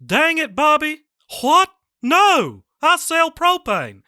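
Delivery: high, loud Texan dad voice; bad Hank Hill, high and strained; Hank Hill impression. Shown as a demonstration. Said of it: "this is my Hank Hill".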